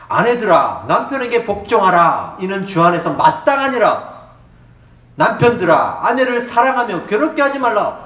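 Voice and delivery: solemn loud scripture read. Acted. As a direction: proclaim the verses to the congregation. Reads scripture aloud.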